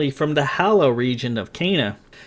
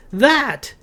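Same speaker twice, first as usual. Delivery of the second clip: high, sharp and impatient; 12 semitones higher than usual. A narrator's reading. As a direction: snap it out, losing patience. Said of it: "she said impatiently".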